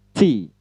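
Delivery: "T" with a hissing burst of air; tongue behind upper teeth for a puffed "T"; aspirated English T. Shown as a demonstration. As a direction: push trapped air out on "T". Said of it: "ada letupan angin".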